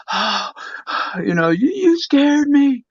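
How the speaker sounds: high mom voice with a sighed breath; imitating shaken mom. Son imitating his mom after the scare, shaken relief.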